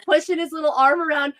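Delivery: ordinary talk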